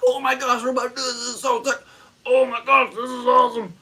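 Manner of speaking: high, loud squealing hype; mock-giddy festival squeal; giddy excitement. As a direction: gush like you're about to enter the festival. Hyped in ticket line.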